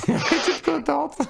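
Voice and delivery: high squeaky laugh through words. Real, not acted. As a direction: giggle it out slowly. Laughing after teasing a guest. (rire aigu)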